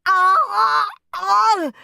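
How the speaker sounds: loud, high cries of alarm; high shriek. A narrator's reading. Peter attacked by Henry, shrieking. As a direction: shriek twice, high and long. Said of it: "shrieked Peter".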